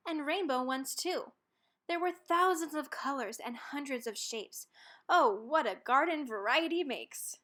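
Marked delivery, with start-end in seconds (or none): none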